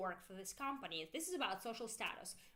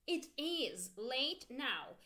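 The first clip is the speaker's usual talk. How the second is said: "is" drawn out and stressed; slow, emphatic IS in "it is late"; much slower than usual. Shown as a demonstration. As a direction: lean hard on the word "is". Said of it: "we make the emphasis with our intonation".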